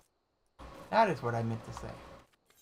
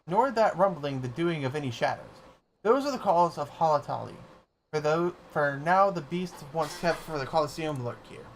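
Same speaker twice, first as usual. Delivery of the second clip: lightly voiced grave gatekeeper, ceremonial; a hint of a stately keeper of ruins; solemn gatekeeper; 4 semitones higher, 5 dB louder than usual. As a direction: announce it like an old guardian at a gate.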